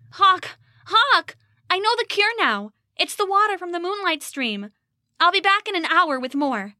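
high, desperate urgent cry; frantic calling. Acted. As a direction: call out to your dying brother. Rose finds brother collapsed.

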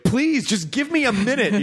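high-pitched mock begging; acted-out frazzled pleading; exasperated plea. Acts exasperated plea to excited dog.

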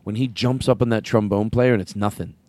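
ordinary talk

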